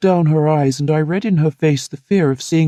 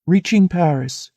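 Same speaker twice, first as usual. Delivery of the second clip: ordinary delivery, nothing marked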